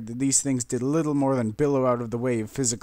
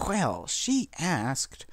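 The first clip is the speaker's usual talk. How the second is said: arguing Rarity; 4 semitones higher, much slower than usual. A narrator's reading. Rarity answering Rainbow Dash, slow and defensive. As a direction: defend herself primly. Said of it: "Rarity argued".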